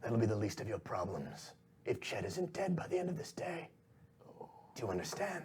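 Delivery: soft, restrained threat; quiet villain warning; menacing shadowy boss. Acted. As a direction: threaten the lackeys under your breath. Boss threatens his henchmen.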